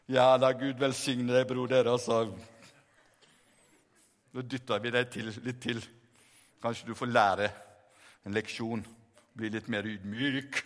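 very deep, droning sanctimony; mock-pious brother. Brothers push Peter under to teach humility, deep mock-pious drone. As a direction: sink low and drone it piously.

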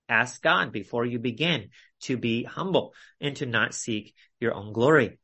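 ordinary delivery

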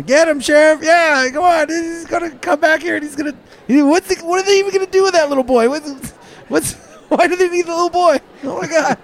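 high, loud frantic townsfolk yelling; excited crowd cheer. Acted. Crowd member yelling at the sheriff, high and frantic. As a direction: cheer the sheriff on in a shrill panic.

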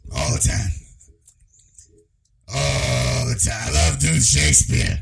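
slow, drawn-out hammed-up old actor; Pacino. Mocking how the old actor sounds now, slow hammy impression.

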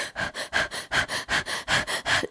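rapid, audible puffs of breath; panicked breathing. Shown as a demonstration. Nervous swimmer showing how they breathed while floating, panicked breathing. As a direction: imitate quick panicky breathing, no words. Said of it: "I was kinda breathing like this".

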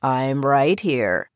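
low, slow, calm frog voice; deep and untroubled. A narrator's reading. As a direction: answer unhurriedly, down deep. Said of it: "the voice said calmly".

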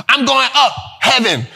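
shouted line, dropped tag word. Retold or imitated. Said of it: "when she used to talk like that".

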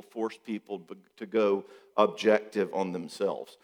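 ordinary delivery

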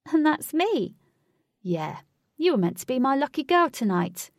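high, loud entitlement; indignant demand. A narrator's reading. Huey berating Katie for refusing him, indignant.

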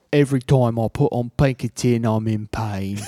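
mock Cockney film-star voice; Michael Caine impression. Imitating a British actor moaning about an album, Cockney accent. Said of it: "Michael Caine would be like".

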